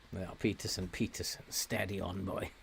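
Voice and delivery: soft, whispered calming; steady the player quietly; hushed. Real, not acted.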